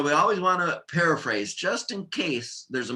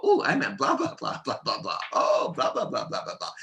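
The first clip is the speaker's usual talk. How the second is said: acted sudden realisation. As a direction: play someone jumping in to correct themselves. Acted speaker correcting a paraphrase.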